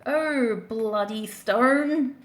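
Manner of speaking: drawn-out Australian "bloody stone"; Australian exclamation, stretched out; Australian accent. Acted. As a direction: say it slowly in a broad Australian accent. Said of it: "Very Australian there".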